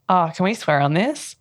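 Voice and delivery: ordinary talk, nothing marked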